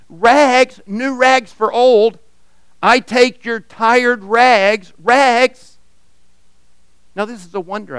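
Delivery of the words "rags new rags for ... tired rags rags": high, loud and slow hawker's cry; calling out. Acted. Ragman offers new rags for old, slow ringing call. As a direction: sing out the offer down the street. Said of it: "calling out in a clear tenor voice".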